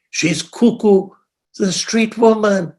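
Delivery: high, slow disbelief; incredulous. Retold or imitated. He doubts her offer.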